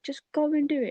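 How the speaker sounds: high-pitched, impatient urging; urging sister. Retold or imitated. Sister urging her nervous sibling on, high insistent nudge. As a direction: push them into it, voice pitched right up. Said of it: "my sister was like".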